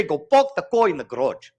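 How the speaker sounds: "Go park the car in the garage." with rounded Cape vowels, pawk, caw, grodge; Cape Town accent. Shown as a demonstration. Teacher imitating the Cape Town version for the class. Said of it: "You say".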